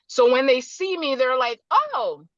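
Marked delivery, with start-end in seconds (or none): 1.7-2.2 higher-pitched "oh" of surprise; mock surprise. Phone contacts meeting her in person, imitated surprise. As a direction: act out someone taken mildly aback. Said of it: "in surprise, they're thinking".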